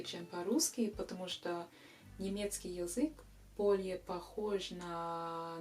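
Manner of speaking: ordinary talk, nothing marked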